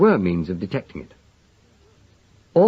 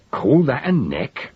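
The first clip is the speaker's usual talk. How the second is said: Lugg to pig; 6 semitones higher, 3 dB louder, slower than usual. A narrator's reading.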